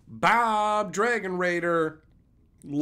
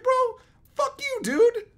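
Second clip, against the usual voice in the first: much higher, louder strained mock wail; inner screaming voice; 5 dB louder, slower than usual. His inner voice cursing the grumpy old man, shrill mock wail. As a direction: squeal the curse like a pent-up inner scream.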